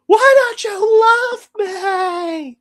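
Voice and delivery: very loud, high drawn-out wail; hammed-up screaming sob; imitated wailing cry. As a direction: howl it slowly in mock anguish. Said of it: "and then you just hear".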